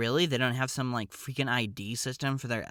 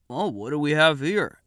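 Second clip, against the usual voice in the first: louder, higher mock-suspicious desk guy; old curmudgeon; 4 semitones higher, 8 dB louder than usual. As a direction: play a grumpy older clerk discovering troublemakers. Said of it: "he's more of a curmudgeon".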